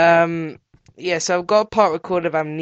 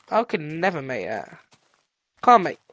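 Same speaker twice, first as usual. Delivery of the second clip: ordinary delivery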